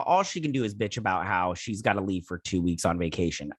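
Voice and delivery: ordinary delivery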